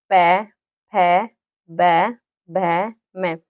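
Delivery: पै फै बै भै मै spoken slowly, each one with both lips closing; slow, deliberate bilabial syllables; lip sounds demo. Shown as a demonstration. Teacher showing the class how the lips make these sounds, slow and clear. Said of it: "दोनों होठों से बोला जा रहा है".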